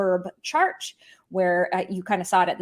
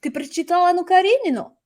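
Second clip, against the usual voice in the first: breezy high, louder "hey, what's up"; casual greeting; 8 semitones higher, 4 dB louder than usual. Pretend. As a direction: play it as an offhand chat with a friend. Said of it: "walk up to somebody and be like".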